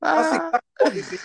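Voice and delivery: high-pitched, laughing through the words; can't keep a straight face; giggling insistence. Real, not acted. Laughing at own analogy.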